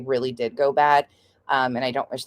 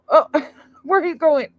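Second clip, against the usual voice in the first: ordinary talk, nothing marked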